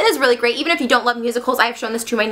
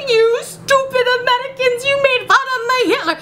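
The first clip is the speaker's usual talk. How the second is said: high, strained furious cry; hammed-up outraged madman; mock rage; 12 semitones higher than usual. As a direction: shriek it as a cartoon villain. Hans enraged with a gun.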